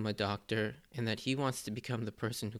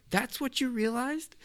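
much higher cartoon voice, exasperated; imitating Sanji; 14 semitones higher than usual. Sanji exasperated at Luffy's realization, high mock voice.

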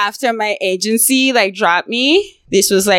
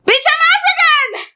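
indignant shout. Retold or imitated. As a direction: hurl it back at her. Snapping back at a rude question.

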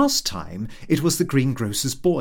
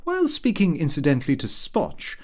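ordinary delivery

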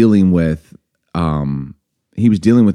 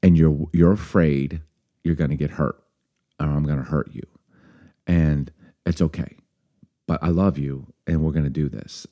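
soft, low and tender reassurance; lovingly; 3 semitones lower, 5 dB quieter than usual. Retold or imitated. Girlfriend reassuring frightened boyfriend on the phone, lovingly. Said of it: "you said it really lovingly as a girlfriend".

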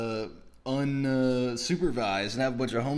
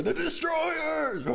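much higher, slow shouted title; announcer shout; much slower than usual. Pretend. Mock-announcing the show title, slow and high. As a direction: yell it like a TV intro.

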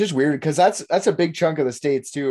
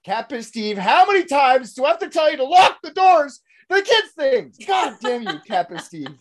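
mock scolding; 10 semitones higher than usual. Scolding the drunk.

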